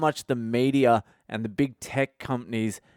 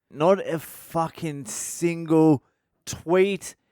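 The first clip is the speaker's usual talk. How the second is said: each word stretched out slowly, tweet pitched way up; slow emphatic rant; 5 semitones higher, much slower than usual. Real, not acted. Ranting to listeners about nobody posting, slow stressed outrage. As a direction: drag out not a fucking single, then spike tweet.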